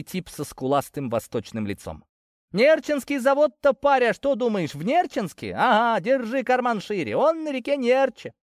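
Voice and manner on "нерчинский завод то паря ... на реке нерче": much higher, mocking peasant voice; high-pitched, scornful know-it-all; jeering young local. A narrator's reading. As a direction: jeer at a clueless stranger in a high voice. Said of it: "передразнил меня тот, что помоложе".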